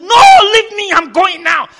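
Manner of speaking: high, loud, brushing them off; insistent shout. Retold or imitated. Young man refusing to calm down, shouting. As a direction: push past them, you're going no matter what. Retells insisting on going to sick bay.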